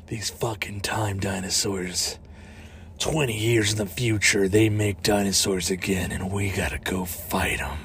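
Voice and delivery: low, hushed mock-dramatic delivery; growing ominous, soft and low; grave trailer voice. Voicing a fake time dinosaur movie, grave and hushed.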